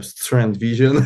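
"Strength Vision" in a slow, mock English accent. Shown as a demonstration. Athlete plugging his channel's name, mock English accent. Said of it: "avec mon accent anglais incroyable".

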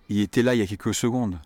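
il était là il y a quelques secondes blurred together quickly; the L of il dropped, words run together; quick everyday French; fast natural version. Shown as a demonstration.

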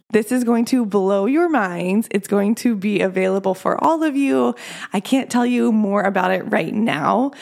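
ordinary delivery

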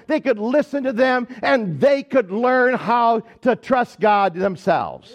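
slow, stretched sermon delivery; emphatic preaching. Real, not acted. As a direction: drive the point home like a preacher. Preaches the main point emphatically.

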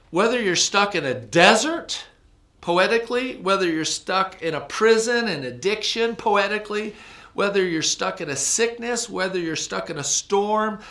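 high, loud building preaching; preaching at full force. Real, not acted. Preacher rousing congregation with Psalm 107, high and loud. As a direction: hammer each "whether you're stuck" up high. Preaching climax, rising list.

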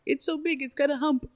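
high, soft voice of wonder; childlike awe. Retold or imitated. Fourteen-year-old at the window seeing the jumbo, high hushed awe. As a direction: marvel like an awed young teenager.

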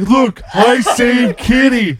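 slow, high, loud proud announcement; simpleton hero. Acted. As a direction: show off the rescue like a big simpleton. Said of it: "be like".

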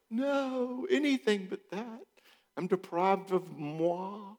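high falsetto, slow camp despair; mock dramatic. Mocking the sulking daughter in high camp voice. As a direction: ham up tragic loss, way up high. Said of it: "I used that line".

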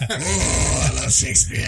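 hammed-up old actor, lightly voiced; drawn-out mock thespian impression; Pacino. Repeating the actor bit for friends, hammy impression.

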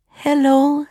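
a timid hello, mildly nervous. A narrator's reading. Lost princess greets seven strangers, nervous. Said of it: "she said nervously".

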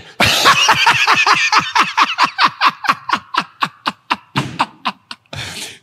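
long high-pitched cackle; real helpless laughter; high laughing fit. Real, not acted. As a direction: lose it laughing. Laughing at own [name] bit.

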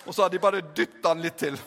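ordinary talk, nothing marked

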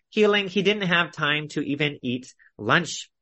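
ordinary talk

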